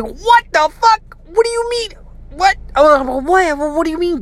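high-pitched, squealed disbelief; frantic, stammered high questions; mock panic. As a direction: act out a panicked kid, voice shooting way up. Retold panic at brother's warning.

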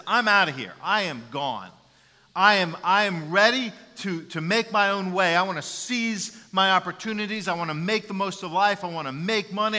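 loud, eager son declaring his freedom; impassioned speech. Retold or imitated. Son telling his parents he is leaving, loud and impassioned. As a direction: belt it out like a young man leaving home. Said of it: "he goes in this impassioned speech".

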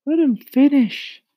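high loud ladybug voice. A narrator's reading. Ladybug speaks.

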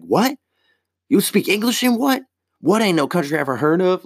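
high incredulous movie-tough-guy challenge; Samuel L. Jackson impression. Imagined comeback to the rude lady, high mock-menacing. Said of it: "go full Samuel L. Jackson on her".

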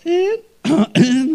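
failed deep voice that cracks high. Retold or imitated. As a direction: try to go low and let it crack. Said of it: "deep voice to match hers".

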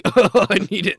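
exaggerated needy outburst; mock desperate craving. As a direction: beg like a joke junkie. Acts out a craving addict, joking.

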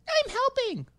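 very high, squeaky cartoon kid; Ralph voice. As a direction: squeak it out like a little cartoon boy. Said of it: "high squeaky voice".

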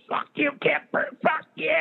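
choked mock curse. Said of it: "Joe starts going".

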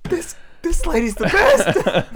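high, slow words through laughter; laughing. Retold or imitated. Student loves the teacher, high laughing and slow. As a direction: gush while cracking up. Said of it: "I was like".

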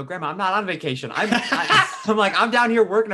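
can't keep a straight face; laughing while correcting grandma. Real, not acted.

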